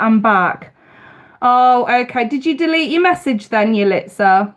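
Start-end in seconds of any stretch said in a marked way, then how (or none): none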